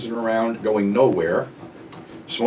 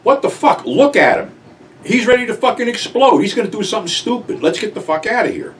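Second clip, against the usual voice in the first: alarmed urging; 10 semitones higher, 3 dB louder than usual. Retold or imitated. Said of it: "I said".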